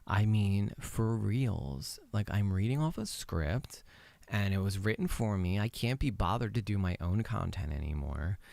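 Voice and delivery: low, quiet, flat-toned monotone. Retold or imitated. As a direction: drone on like a bored script reader. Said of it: "I talked like this the whole entire time".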